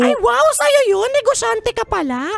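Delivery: very high excited voice. Acted. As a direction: squeal it up high.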